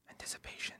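whispered after a long pause; suspense whisper. Pretend. Host building suspense before reading a username, whispered. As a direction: hush it like a drumroll. Host teases next question's sender.

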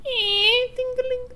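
very high sung bell chime; doorbell noise. Acted. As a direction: chime like a shop door bell. Shop bell as someone enters.

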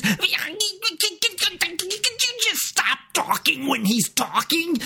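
very high, hammed-up exasperation; squeaky-high mock outburst; mock frustration. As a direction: imitate a flustered man losing his patience. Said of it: "get really, really frustrated like that".

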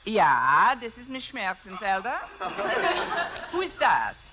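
put-on thick comic German accent. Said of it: "If I can do a decent German accent".

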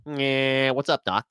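lightly voiced cartoon catchphrase; a hint of cartoon wiseguy; Bugs Bunny. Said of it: "Bugs Bunny".

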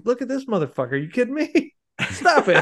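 high, incredulous mock rant; hammed-up disbelief, ending in a laugh; mock exasperated ogre. Mocking Shrek's reply to his wife, high and incredulous.

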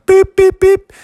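high loud beeping noises; phone beeps. Acted. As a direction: mimic dialing tones.

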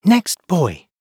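ordinary delivery

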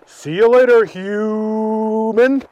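high, loud friendly cow voice; a cheery cow calling goodbye; talking cow. Acted. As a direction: cows seeing the boy off, high and loud. Cows saying goodbye.

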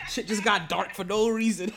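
talking through real laughter; laughing. Real, not acted. Host reacts to six six six joke, laughing. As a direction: let the laugh spill into the words.

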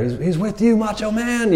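preacher riffing on the angel as Macho Man, high promo yell; Macho Man hype. Retold or imitated. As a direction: hype him like a wrestling announcer. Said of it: "Macho Man Randy Savage right there".

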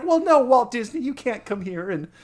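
lightly voiced high scolding manager; Sandy Duncan as park manager. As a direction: shoo off the developer, a hint of sitcom. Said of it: "He's like".

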